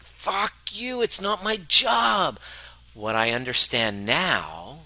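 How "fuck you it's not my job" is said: much higher, slow frustrated outburst; hammed-up exasperated comeback; mock angry retort. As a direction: snap back like a fed-up partner. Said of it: "I'm like".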